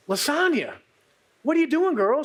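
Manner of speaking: put-on playful girlfriend voice; lively friend Megan. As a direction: call out to her like a surprised bestie.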